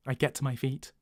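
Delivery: ordinary delivery, nothing marked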